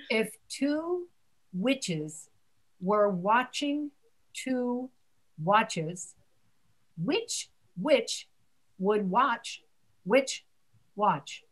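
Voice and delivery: slow, word by word; slowly. Shown as a demonstration. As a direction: give each word its own beat. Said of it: "let me say it slowly".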